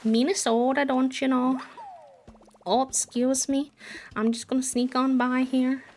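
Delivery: high, perky Minnesotan caricature with round O's; strong Minnesotan accent. Acted. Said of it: "a strong Minnesotan accent".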